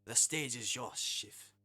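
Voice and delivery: mock-French chef laying down terms; hammed-up French customer, badly faked; French chef host. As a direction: issue the challenge in a fake French accent. Reads French chef character's lines.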